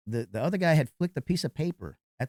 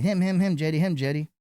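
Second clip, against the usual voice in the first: higher, louder, insistent repeats; imitated urgent accusing; 6 semitones higher, 5 dB louder than usual. Bouncer imitating the crowd pointing at the culprit, urgent and insistent. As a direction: mimic a crowd urgently singling someone out.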